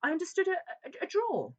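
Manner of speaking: barely holding back hysteria; hysterical strain. Acted. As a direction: visitor choking back hysteria about the drawer. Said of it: "wrestling against the approaches of the hysteria".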